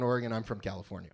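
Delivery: usual speaking voice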